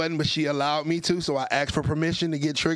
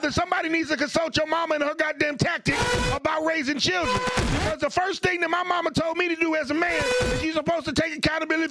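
high-pitched, full-voiced angry shouting tirade; worked-up, relentless shouted rant; triggered rant; 9 semitones higher than usual. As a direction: yell it like a fed-up preacher. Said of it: "am I allowed to get triggered".

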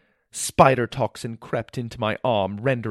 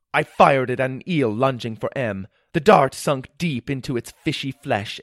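ordinary delivery, nothing marked

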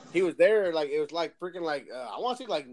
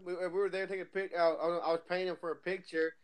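ordinary talk

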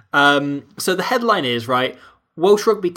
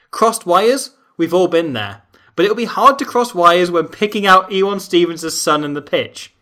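a touch louder, overblown journalism read; hammed-up reporter prose, louder; mock-grand article reading; 3 semitones higher, 5 dB louder than usual. Shown as a demonstration. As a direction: read it out like pompous purple prose. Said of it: "This is the start of the article".

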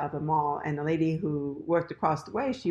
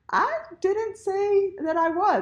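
indignant protest; 11 semitones higher than usual. Retold or imitated. Defending herself to boyfriend's mother, mock-indignant protest. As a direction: protest your innocence in a retold squeak.

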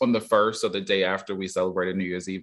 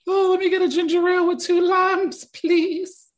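high, prissy ordering voice; high mock voice; 4 dB louder than usual. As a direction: ask sweetly in a high voice.

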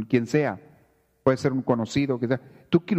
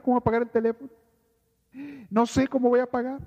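mock panic, pitched higher, piling up worries; anxious fretting; 7 semitones higher than usual. Preacher imitates a worrier fretting over rent and job, high and anxious. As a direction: fret like someone lying awake over bills. Person worrying about the future.

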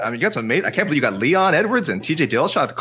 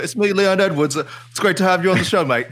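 higher, bright-voiced mock Londoner; Leon Edwards impression; 6 semitones higher than usual. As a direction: greet him like a hammed-up British fighter. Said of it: "You sound like Gareth Davies".

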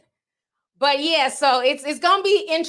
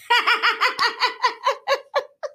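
high laugh; 10 semitones higher than usual. Real, not acted. Laughing at the joke.

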